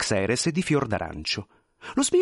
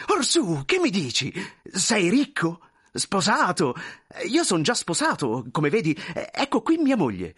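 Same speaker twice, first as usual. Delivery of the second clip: high, excitable character voice; breathless-with-joy chatter in a raised pitch; effusive thin man, overjoyed; 6 semitones higher than usual. Acted. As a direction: gush and show off the family to an old friend. Thin man gushes at old friend.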